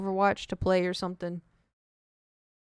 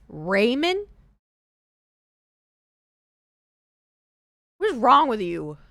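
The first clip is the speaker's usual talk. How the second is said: louder outraged question; indignant; 4 semitones higher, 7 dB louder than usual. Real, not acted. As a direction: call out the pronunciation. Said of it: "outraged at pronunciation".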